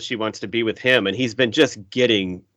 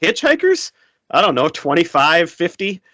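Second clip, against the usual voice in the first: offhand killer; 6 semitones higher, 4 dB louder than usual. Retold or imitated. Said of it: "And he's like".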